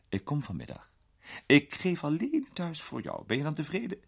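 a hint of a grand old lady, amused; an elderly duchess teasing a young man; lightly voiced duchess, amused.

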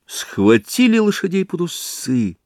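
usual speaking voice

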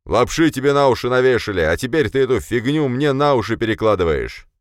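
loud, high-pitched angry outburst; shouting. A narrator's reading. Boss berates gullible subordinate, shouting. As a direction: yell the accusation at full tilt. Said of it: "А затем перешёл на крик".

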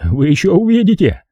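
high, overwrought threat; breaking voice. A narrator's reading. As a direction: choke it out, shaking with rage. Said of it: "пробормотал он прерывающимся голосом". (прерывающимся от ярости голосом)

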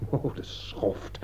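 slow, breathless unvoiced curse under the breath; muttered curse. Realizing the nephew's guilt, he curses him under his breath. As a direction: spit the insult quietly to yourself.

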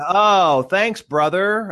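high, slow dry sarcasm; mock-grateful sister, drawn out; sarcastic thanks. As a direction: thank him like he insulted you. Sansa's sarcastic reply to Bran.

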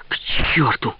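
whispered curse to himself; muttering to himself. A narrator's reading. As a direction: whisper it bitterly under your breath. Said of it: "говорил он сам себе". (шёпотом, сам себе)

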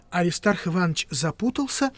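plain reading voice

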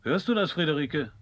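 laut und höher nach ihr rufen; rufend. Acted. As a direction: der Frau im Nebenzimmer laut zurufen. Ruft seiner Frau zu. (ruft Friederike laut und hoch zu)

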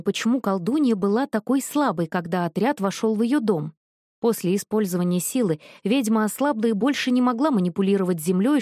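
usual speaking voice

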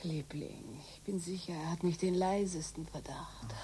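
soft unvoiced whisper to a lover; hushed whisper to her lover; intimate whisper. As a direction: murmur it close, secretly. Lovers' secret talk.

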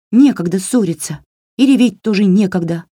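perky, scolding doll voice; brisk bossy doll. A narrator's reading. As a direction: hurry her along, no nonsense. Doll hurries the girl.

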